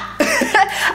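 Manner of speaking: short high laugh at her own joke; quick amused laugh; burst of laughter. Real, not acted.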